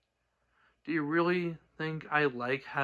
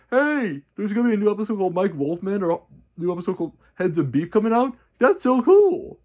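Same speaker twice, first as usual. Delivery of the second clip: high, loud mock-excited fan voice; 5 semitones higher, 8 dB louder than usual. Creator mocking fans fooled by fake episode news, mock excited. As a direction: parody a gullible fan gushing.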